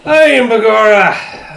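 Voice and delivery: hammed-up Irish brogue declaration; mock Irish accent. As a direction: proclaim it in a fake Irish lilt. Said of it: "making the right words".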